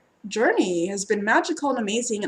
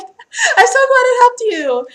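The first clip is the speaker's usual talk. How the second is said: much higher and louder, slow, laughing through it; mock sweet thank-you; 14 semitones higher, 11 dB louder, slower than usual. Reader mimics herself thanking a viewer, high laughing gush. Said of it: "It's like".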